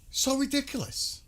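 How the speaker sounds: mock the whole idea in a silly high tone; high mocking falsetto echo. Repeats in put-on mocking voice.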